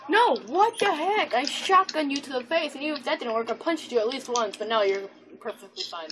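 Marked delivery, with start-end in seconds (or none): 0.1-1.3 high, drawn-out disbelief; indignant protest. Real, not acted. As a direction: protest the unfairness in a high, stretched voice. Protests an unfair game result.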